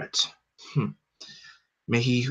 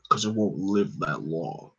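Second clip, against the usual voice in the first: deep, slow monotone brute; flat, heavy, slow menace; Hulk voice; 5 semitones lower, 6 dB quieter than usual. Acted. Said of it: "deep, monotone version of my voice (Hulk)".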